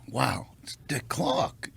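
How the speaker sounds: breathless hushed whisper, starstruck; awed whisper. Real, not acted. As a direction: whisper it in awe at a TV legend. Awed at meeting Dick Clark.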